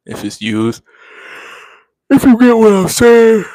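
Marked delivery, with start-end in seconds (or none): 2.1-3.5 words stretched through a loud high yawn. Real, not acted. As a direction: talk right through a yawn.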